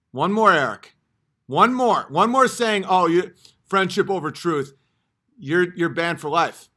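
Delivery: loud furious warning at Eric; angry shout. Real, not acted. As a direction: bark the last warning.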